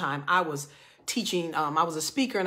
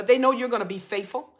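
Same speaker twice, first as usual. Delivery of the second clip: ordinary talk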